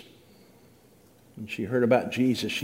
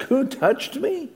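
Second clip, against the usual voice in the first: mock incredulous; 11 semitones higher, slower than usual. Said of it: "his disciple answered".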